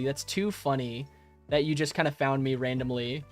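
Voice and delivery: normal talking voice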